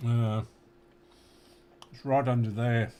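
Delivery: talking through a mouthful of pizza; mouth full. Real, not acted. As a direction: speak round a bite of food. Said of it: "Excuse me talking with my mouth full".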